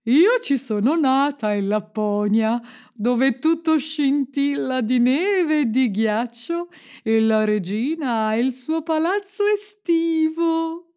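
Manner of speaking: slow, drawn-out reindeer voice, rising high; talking reindeer. A narrator's reading. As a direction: stretch it out dreamily, climbing in pitch. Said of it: "disse la renna".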